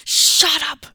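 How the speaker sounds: loud furious yell. Acted. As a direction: scream it at him. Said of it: "yelled Shu".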